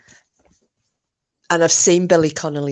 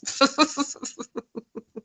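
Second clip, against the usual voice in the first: laughing; 10 semitones higher, 5 dB quieter than usual. Real, not acted. Laughing at nan memory.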